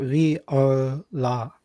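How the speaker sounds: "Viola" slowly as vee-oh-la; sounded-out pronunciation. Shown as a demonstration. Working out the bike's name, "Viola" sounded out vee-oh-la. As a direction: draw "Viola" out syllable by syllable, starting VEE. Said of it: "How do you pronounce this?".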